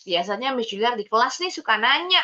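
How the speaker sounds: ordinary delivery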